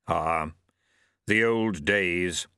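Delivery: low, slow, nostalgic Death; wistful grim reaper. Acted. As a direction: sigh over the old days.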